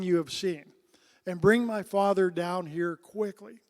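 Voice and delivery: solemn scripture read to the congregation. Acted. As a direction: read the passage out with weight. Reading scripture aloud.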